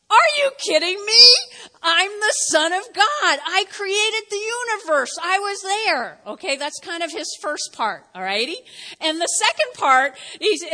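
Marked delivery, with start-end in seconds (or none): 0.1-6.2 higher, louder playful outrage; mock indignant. Pastor voicing Jesus to the congregation, mock indignant. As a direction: play it up like offended royalty.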